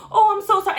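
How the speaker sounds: high, loud put-on gushing; mock-apologetic lady voice, high and loud; mimicked apology. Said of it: "She's like".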